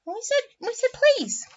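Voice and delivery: high-pitched mock protest; squeaky playful reminder; pleading with him. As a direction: insist in a small, high voice. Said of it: "I'm like".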